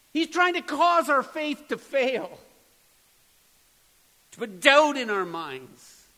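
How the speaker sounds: preacher rising to full force; preaching at full force. Acted. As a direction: drive the question home to the congregation.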